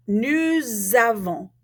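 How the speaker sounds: "Nous avons" slowly joined by a z; z liaison. Shown as a demonstration. Teacher repeating for students, "Nous avons" with slow z liaison. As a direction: link "Nous" to "avons" with a drawn-out z. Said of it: "turn it into a Z".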